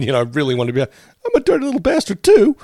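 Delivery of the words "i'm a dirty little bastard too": cartoon kid voice. Wanting to be Stan.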